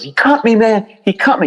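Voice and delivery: put-on high, pained yell of a cut drunk; drunk man screaming. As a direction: cry out high like you've just been slashed. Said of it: "he starts screaming".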